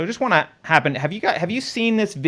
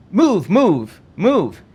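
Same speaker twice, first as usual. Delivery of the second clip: mildly raised, higher-pitched repeated command; urgent command; 6 semitones higher, 4 dB louder, much slower than usual. Retold or imitated. Reading out a male voice from the shooting video, mildly urgent call. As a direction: imitate someone urgently ordering people along.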